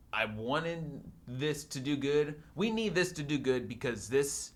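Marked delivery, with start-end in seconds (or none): none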